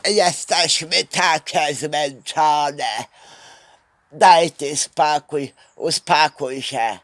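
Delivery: slow, dragging parody of weary women; hammed-up tired moan, slow; mock exhausted whine. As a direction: drawl every word as if half-asleep. Mocking tired co-workers.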